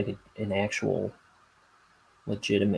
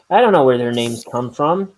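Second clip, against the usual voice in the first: exasperated; 7 semitones higher, 9 dB louder than usual. Real, not acted. Exasperated about names.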